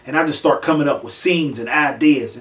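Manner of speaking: normal talking voice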